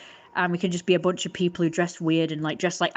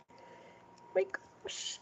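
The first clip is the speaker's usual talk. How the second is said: very quiet whisper, pausing before the last word; whispered dismay; 14 semitones higher, 15 dB quieter, much slower than usual. Real, not acted. Worried about the damaged drawing, whispered dismay. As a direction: breathe it out in a whisper.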